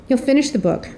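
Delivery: normal talking voice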